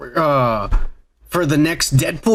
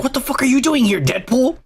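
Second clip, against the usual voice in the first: oburzone zdziwienie; 6 semitones higher than usual. Retold or imitated. Retelling Spider-Man confronting Deadpool.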